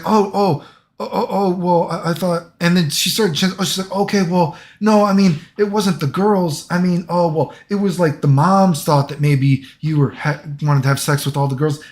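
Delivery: mock nervous boss lady, hemming and hawing; flustered boss lady. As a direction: stammer out the accusation like a nervous manager. Boss lady backpedalling, voiced.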